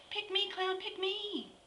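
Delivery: put-on high, squeaky child voices begging; eager kids' squeal. Kids begging the clown, high squeaky plea. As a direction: squeal like excited kids wanting picked.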